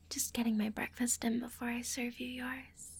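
ordinary talk

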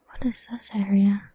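usual speaking voice

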